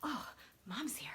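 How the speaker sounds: put-on hushed whisper of a relieved baby; whispered baby relief. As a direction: breathe it like a comforted infant. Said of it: "They're like".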